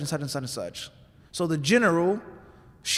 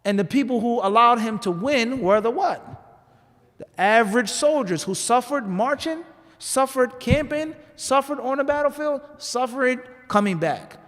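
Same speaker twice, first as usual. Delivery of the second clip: rising, higher with each "suffered"; preaching build; 6 semitones higher than usual. Acted. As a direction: climb the list like a preacher building. Preacher lists the soldiers' suffering.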